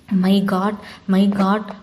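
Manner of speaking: plain reading voice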